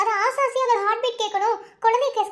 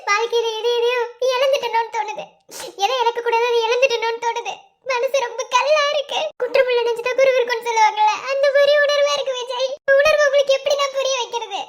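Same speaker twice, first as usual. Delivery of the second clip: tearful, sobbing confession; crying. Acted. Wife confiding her inner struggle to her husband, crying. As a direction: pour it out through sobs. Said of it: "இப்ப எதுக்கு நீ அழுதுட்டு இருக்க".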